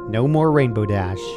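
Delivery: ordinary delivery, nothing marked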